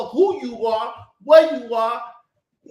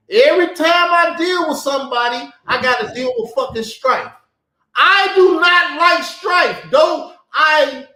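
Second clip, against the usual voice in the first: heated shouting; 5 semitones higher, 7 dB louder than usual. Real, not acted. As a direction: shout it in real anger about strife. Heated rant about hating strife.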